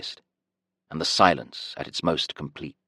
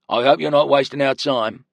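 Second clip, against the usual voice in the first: higher, louder suspicious guard voice; suspicious warning; 9 semitones higher, 4 dB louder than usual. A narrator's reading. Guard suspicious of the prisoner, sharp and louder. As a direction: warn him not to waste time. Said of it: "He looked suspiciously at the prisoner".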